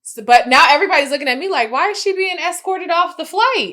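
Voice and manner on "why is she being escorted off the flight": high, louder mock gossip; scandalized onlookers. Passengers watch her leave the plane, scandalized mock question. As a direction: voice the nosy passengers, pitched up.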